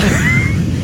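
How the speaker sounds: a sudden very high squeal of laughter. Real, not acted. Host loses it at the heavy breathing with a squealing laugh. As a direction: burst out in a high shriek of a laugh.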